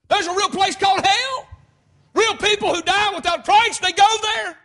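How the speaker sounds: high, loud hellfire preaching; full-force preaching. Real, not acted. Preacher warning the congregation about hell, full-force preaching. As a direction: hammer each phrase out to the crowd.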